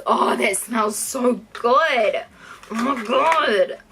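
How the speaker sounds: slow, drawn-out swoon over a scent; savouring delight. Real, not acted. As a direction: stretch it out, relishing the smell. Savouring the sour apple smell.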